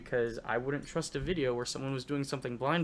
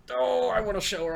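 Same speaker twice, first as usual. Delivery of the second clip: excited; 9 semitones higher, 5 dB louder than usual. Retold or imitated. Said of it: "and I was like".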